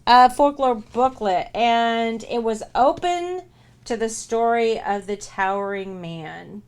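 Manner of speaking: slow spooky announcement; dramatic slow read. Real, not acted. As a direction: lay out each word for suspense. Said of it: "reading the clue dramatically".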